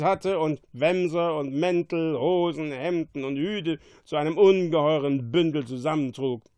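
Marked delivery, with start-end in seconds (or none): none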